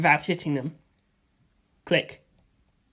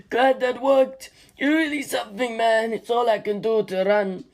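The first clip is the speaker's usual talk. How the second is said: Stephen's voice; 4 semitones higher than usual. Acted.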